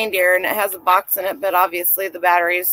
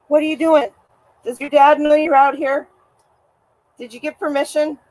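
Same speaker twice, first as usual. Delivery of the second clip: higher, playful questions to a pet; teasing pet voice; 6 semitones higher than usual. Real, not acted. Teasing the neighbour's dog Bear, higher and playful.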